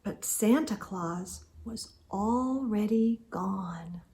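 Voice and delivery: unvoiced hush; hushed narration; whisper. Real, not acted. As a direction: whisper the ending softly. Hushed story aside.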